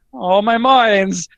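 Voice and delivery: hammed-up drawn-out Aussie complaint; mock lament. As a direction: wail it slowly in mock dismay. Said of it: "He's like".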